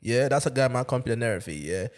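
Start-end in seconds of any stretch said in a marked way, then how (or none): none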